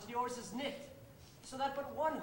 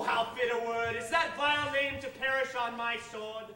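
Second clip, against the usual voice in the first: normal talking voice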